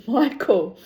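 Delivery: ordinary talk, nothing marked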